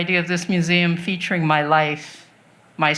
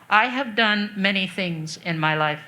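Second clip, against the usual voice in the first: usual speaking voice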